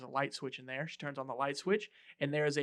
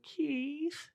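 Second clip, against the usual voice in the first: much higher, rising call of Keith; 13 semitones higher than usual. Retold or imitated. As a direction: call his name nervously up the stairs. Calling out for Keith.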